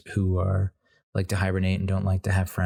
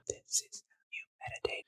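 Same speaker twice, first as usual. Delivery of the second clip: whispered meditation; 13 dB quieter than usual. Shown as a demonstration. Showing how to meditate, a soft whisper.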